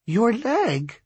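measured, high-pitched character voice; slow, deliberate. A narrator's reading. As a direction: spell it out slowly and weightily. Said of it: "she said slowly".

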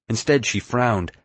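plain reading voice

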